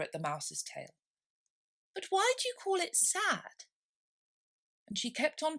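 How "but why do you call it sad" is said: lightly voiced puzzled Alice. A narrator's reading. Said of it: "said Alice".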